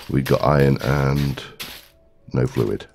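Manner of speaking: normal talking voice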